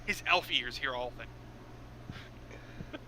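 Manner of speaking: grand narrator. Acted. Mock-grand narrator line.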